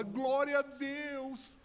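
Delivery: high, drawn-out joyful cry; jubilant exclamation. Retold or imitated. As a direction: stretch it out in hammed-up rejoicing. Daughter exults at father's answer.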